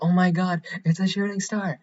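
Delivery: a playful imitation of herself getting thrilled; mock excitement. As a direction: squeal it out like a starstruck fan. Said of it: "I'm gonna be like".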